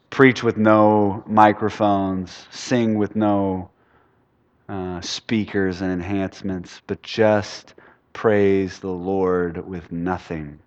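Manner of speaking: slow hushed musing; soft and slow. Real, not acted. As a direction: ease into it, almost to yourself. Pastor musing on bare worship.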